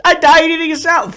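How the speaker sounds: high, laughing, pleading whine; whiny regretful voice. Pretend. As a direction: whine it like your last wish. Imagined dying man's last regret.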